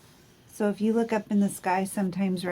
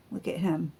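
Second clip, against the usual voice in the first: ordinary delivery, nothing marked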